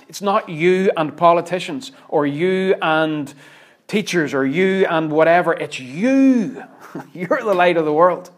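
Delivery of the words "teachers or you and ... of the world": normal talking voice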